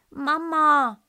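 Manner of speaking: high, loud cry for mama; a lost cub calling his mother. Acted. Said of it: "rief er leise".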